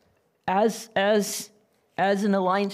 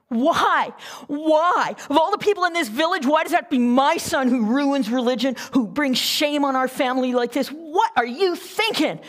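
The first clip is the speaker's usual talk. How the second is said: angry father; 4 semitones higher, 4 dB louder than usual. Retold or imitated.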